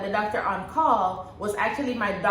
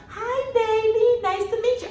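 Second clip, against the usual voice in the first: tender greeting; 13 semitones higher than usual. Retold or imitated. Mother greets her newborn son, high and tender.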